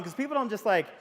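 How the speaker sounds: usual speaking voice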